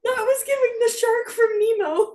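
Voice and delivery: high-pitched, nearly crying with laughter; laughing tease. Real, not acted. Friend mocking a botched accent, high tearful laughter. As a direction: gasp out the joke while cracking up. Teasing the accent while cracking up.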